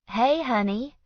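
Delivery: unsure welcome; uncertain. A narrator's reading. Best friend greeting Miranda, uncertain. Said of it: "her voice uncertain".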